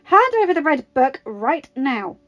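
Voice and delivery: barking an order. A narrator's reading. Natasha demanding the book at gunpoint, barking. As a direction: bark it out, every word weighted. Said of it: "Natasha barked".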